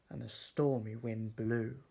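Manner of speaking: ordinary delivery, nothing marked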